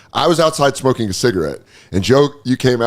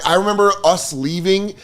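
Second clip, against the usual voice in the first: usual speaking voice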